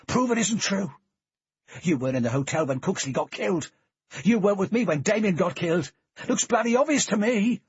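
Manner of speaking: loud, high and fast accusing shout; furious shouting; angry accusation. A narrator's reading. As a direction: hurl the accusation at him. Reed accuses in fight.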